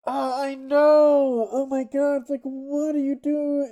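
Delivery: talking into cupped hands; muffled by hands. Real, not acted. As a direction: speak through hands over your mouth. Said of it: "I think he's talking into his hands".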